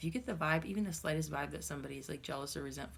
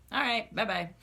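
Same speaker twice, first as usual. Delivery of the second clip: loud, high, breezy brush-off; dismissive bye-bye; 6 semitones higher, 6 dB louder than usual. Pretend. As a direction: wave the haters away cheerily. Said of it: "just be like".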